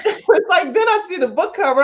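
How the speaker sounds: ordinary talk, nothing marked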